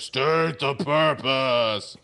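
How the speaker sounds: slow, booming alien being; big booming voice. Retold or imitated. A ball from the console challenges the crew, booming. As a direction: boom it out slowly like a mighty entity. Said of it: "this big booming voice".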